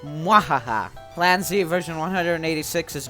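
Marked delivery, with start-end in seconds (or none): 0.0-0.9 evil cackle. Acted. Villain laughs as scene opens.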